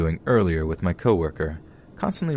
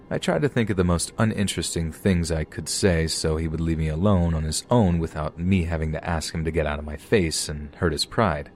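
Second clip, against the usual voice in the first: usual speaking voice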